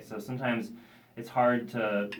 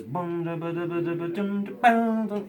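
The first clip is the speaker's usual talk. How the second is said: higher, sung-out guitar rhythm; imitated guitar riff; 5 semitones higher than usual. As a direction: scat the riff in rhythmic syllables. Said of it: "every single note he's playing".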